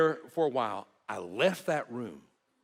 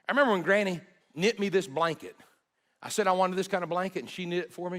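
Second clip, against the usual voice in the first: higher, bright-eyed fond recollection; lightly voicing a laughing grandchild; cheerful retold memory; 7 semitones higher, 4 dB louder than usual. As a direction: grandchild fondly remembering Granny, higher and cheerful.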